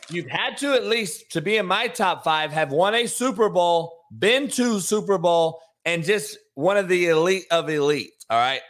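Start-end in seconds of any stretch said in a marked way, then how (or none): none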